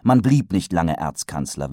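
usual speaking voice

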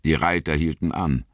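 usual speaking voice

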